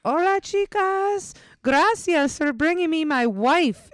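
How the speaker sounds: high, slow put-on accented man's voice; Dominican man's greeting. Stranger greeting the women in the lobby, high and slow. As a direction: drawl it out like a smooth stranger. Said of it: "and he goes".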